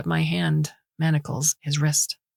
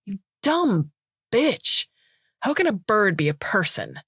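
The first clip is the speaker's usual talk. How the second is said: angry insult; 3 semitones higher than usual. Acted. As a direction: lash out at her. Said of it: "As his anger grows".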